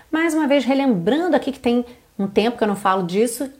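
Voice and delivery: ordinary talk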